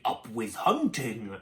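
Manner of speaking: low, slow tyrannosaur chant; understated growly war cry, drawn out; mild mock shout. A narrator's reading. Two tyrannosaurs call for a hunt, mild low shout. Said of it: "they shouted".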